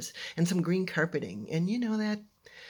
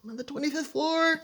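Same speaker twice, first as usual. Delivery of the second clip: high, loud call through a door; hollering for help; 12 semitones higher, 4 dB louder than usual. Retold or imitated. Locked-in girl calling through a door crack, hollering. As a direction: cry out for rescue, re-enacted. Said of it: "Start hollering through the little crack".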